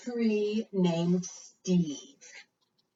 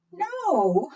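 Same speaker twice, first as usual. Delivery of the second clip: mock surprise; 11 semitones higher than usual. No bird under tree.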